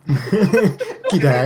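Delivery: loud, laughing Aussie greeting; a cheeky Aussie hello; Australian accent. Retold or imitated. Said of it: "He answered that in his accent".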